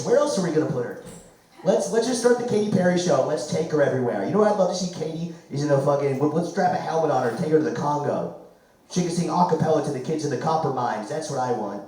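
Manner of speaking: ordinary talk, nothing marked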